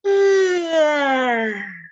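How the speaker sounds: big loud yawn, high, voiced all the way out; a long, stretching yawn with sound; loud yawning sigh. Shown as a demonstration. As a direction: open wide and yawn it out loud. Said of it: "That was a yawn and sound".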